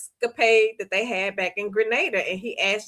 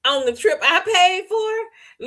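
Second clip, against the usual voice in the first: much higher, slow, outraged question; outraged disbelief; 10 semitones higher, 3 dB louder, slower than usual. Retold or imitated. Fiance hearing where she cheated, high outraged disbelief. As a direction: imitate the fiance learning where she went. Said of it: "Luke was like".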